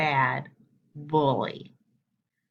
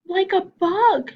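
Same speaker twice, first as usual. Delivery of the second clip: high, slow, louder echo; 12 semitones higher, 7 dB louder, slower than usual. Acted. Younger brother echoing Chester, high and slow. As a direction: echo it back like a little brother. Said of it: "echoed Ronnie".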